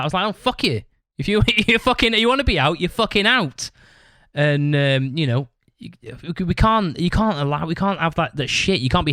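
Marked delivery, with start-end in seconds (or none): 0.3-3.7 mock outburst. Telling the bandmate who quit that he's out, in mock outrage. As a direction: fling it back at him in mock rage.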